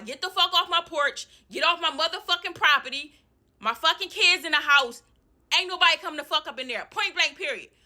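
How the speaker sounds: high, angry yell; furious yelling. Retold or imitated. Tenant orders landlord off the porch, high angry yell. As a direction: order them off the property.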